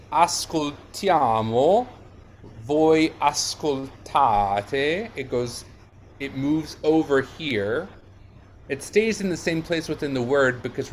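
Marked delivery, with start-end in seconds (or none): 0.1-5.1 ascoltiamo, ascoltate, weight on TIA and TA; stress moved later. Shown as a demonstration. Teacher showing the stress moves for noi and voi, later-syllable emphasis. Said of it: "the stress moves for noi".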